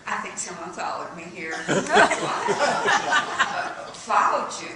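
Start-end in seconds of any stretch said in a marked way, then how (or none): 1.6-3.9 loud, high burst of laughter; nervous high laugh; high nervous giggle. Acted. Nervous giggle at being followed.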